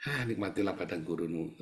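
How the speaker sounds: quiet sarcasm. Retold or imitated. Inner sarcastic thought.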